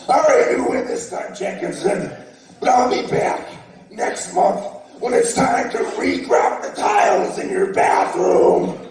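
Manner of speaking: menacing villain voice, drawn out; booming arrogant alien overlord. Pretend. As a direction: threaten like a cartoon overlord. Overlord concedes defeat, promises return.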